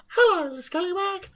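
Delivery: high, squeaky gnome greeting; cartoonishly high little munchkin. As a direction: pipe up like a tiny munchkin. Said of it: "every time he speaks".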